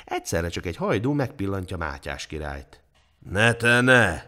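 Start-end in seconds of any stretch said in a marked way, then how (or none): 3.2-4.2 loud, drawn-out rebuke; guard's slow, loud challenge; growling at him. A narrator's reading. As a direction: growl it slowly and loudly at a peasant. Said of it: "mordult rá a hajdú".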